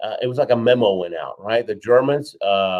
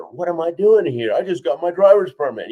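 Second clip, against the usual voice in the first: higher, louder mock-panicked self-doubt; overawed novice; 7 semitones higher, 6 dB louder than usual. Rookie racer among Indianapolis legends, acted higher and louder in alarm. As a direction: play a rookie suddenly out of his depth.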